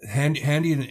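ordinary delivery

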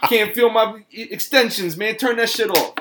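high, loud mock rapper griping about the cold; Wu-Tang complaint. Mocking how they'd ask to turn the heat down, loud and high. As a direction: belt it out like a dramatic Wu-Tang member. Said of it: "They'd be like".

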